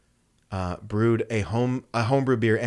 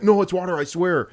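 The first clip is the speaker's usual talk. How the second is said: pleading; 8 semitones higher than usual. Retold or imitated. Brother pleading innocence.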